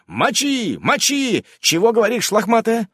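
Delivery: fired-up battle cheering; excited yelling. Acted. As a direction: yell him on in the heat of battle.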